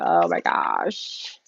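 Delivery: slow, low breathy whisper. Real, not acted. Reader in awe at her cards, whispering. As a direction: hush it out in awe.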